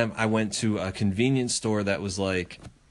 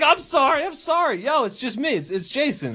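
mock apology; 14 semitones higher than usual. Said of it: "And I'm like".

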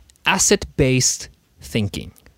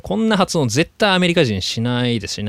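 ordinary talk

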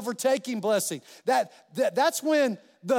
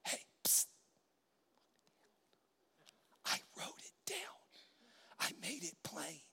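secret, hushed whisper; 4 semitones lower, 19 dB quieter than usual. Retold or imitated. God nudging the praying preacher, whispered. God speaks softly in his ear.

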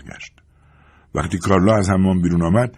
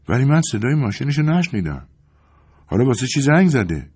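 indifferent; 6 semitones higher than usual. A narrator's reading.

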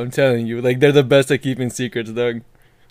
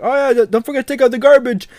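mom voice; 11 semitones higher than usual. Retold or imitated. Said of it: "I just hear his mom's like".

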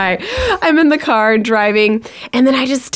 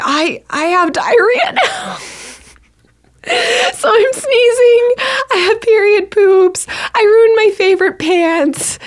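high, drawn-out tearful wail; hammed-up sobbing complaint; mock crying; 12 semitones higher than usual. Acting out tearful misery in bathroom.

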